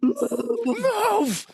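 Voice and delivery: very high re-enacted yell; retold yell. Moviegoer who can't take it anymore, high mock yell. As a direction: blurt it high, as a senseless outburst. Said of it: "And I just yelled".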